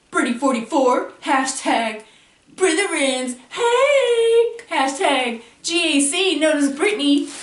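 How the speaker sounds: excited shout-out. Real, not acted. Hyping a fellow creator's channel.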